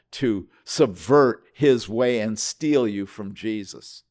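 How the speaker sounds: ordinary talk, nothing marked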